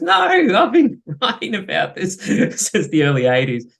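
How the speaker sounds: imitated outburst broken by chuckles; mock protest. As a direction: protest in exaggerated exasperation, laughing. Acts out Paul's laughing protest.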